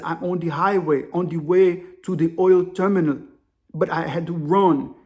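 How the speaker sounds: put-on deliberate African-accented explanation; imitated African caller. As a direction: African accent, words carefully spaced. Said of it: "The African dude's whispering".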